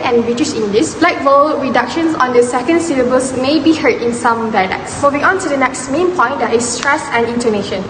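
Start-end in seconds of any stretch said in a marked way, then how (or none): none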